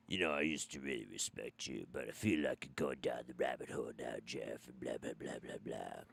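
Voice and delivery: very low, quiet mocking drone; whiny commenter imitation. Host mimicking disappointed commenters, low quiet mocking drone. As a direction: drone it dismissively, trailing into blahs. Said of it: "I definitely got a whole lot of the".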